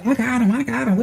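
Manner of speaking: breezy I-got-this promise; mock-casual girlfriend reassurance.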